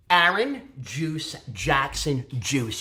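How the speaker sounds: ordinary talk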